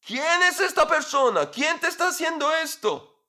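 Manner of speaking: the king bursts out demanding a name; furious king demanding who did this; king shouts. Acted. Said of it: "le grita".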